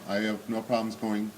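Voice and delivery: ordinary talk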